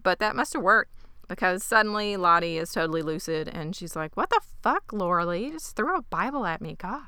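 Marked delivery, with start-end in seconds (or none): 4.2-7.0 high-pitched swooping outrage; mock indignation. Lottie suddenly lucid after the Bible throw, mock indignant. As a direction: imitate an affronted teenage girl.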